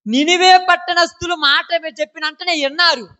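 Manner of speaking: high, loud declamation; preacher's proclamation. Acted. Preacher holds up Nineveh as an example, proclaiming loudly. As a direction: lift the voice and hold it high.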